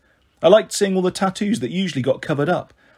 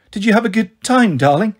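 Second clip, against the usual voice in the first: bright, higher, louder put-on cheerfulness; forced cheer; 5 semitones higher, 4 dB louder than usual. A narrator's reading. Mother hiding hurt from daughter, forced bright cheer. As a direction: brave face over tears, pushed up high. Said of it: "forced her pale pink lips into a silly smile".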